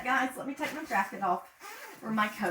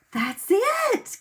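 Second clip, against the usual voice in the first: excited praise; 8 dB louder than usual. Acted. Praising kids' answer.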